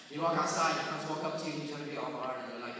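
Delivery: normal talking voice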